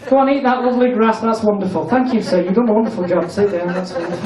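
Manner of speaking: usual speaking voice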